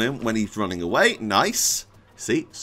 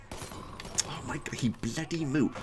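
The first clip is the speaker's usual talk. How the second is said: hushed, barely voiced dismay; deflated disbelief; 11 dB quieter, slower than usual. Real, not acted. Player seeing the enemy has moved, deflated disbelief. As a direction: let it out weakly under your breath.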